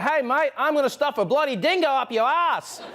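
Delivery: put-on sunny Australian accent on a nasty threat; cheery Australian accent. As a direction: threaten like a chirpy Aussie mate. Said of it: "their accent always sounds upbeat".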